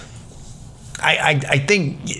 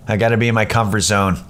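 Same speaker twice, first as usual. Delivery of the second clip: ordinary talk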